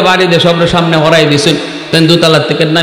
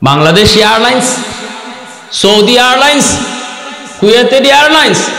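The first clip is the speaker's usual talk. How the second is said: rising rhetorical list; 7 semitones higher than usual. Acted. Preacher lists airlines rhetorically.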